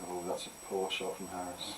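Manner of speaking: hushed, low snooker commentary murmur; snooker commentator voice. Pretend. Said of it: "nice snooker commentator's voice you just did there".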